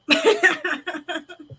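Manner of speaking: a burst of high laughter. Real, not acted. Laughing at own remark.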